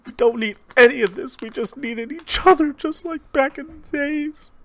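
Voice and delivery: very high falsetto woman, pleading loudly; hammed-up distressed mother; arguing mother. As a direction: protest in a high-pitched wife's voice. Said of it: "your mother and father arguing".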